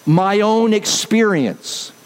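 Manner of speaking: ordinary delivery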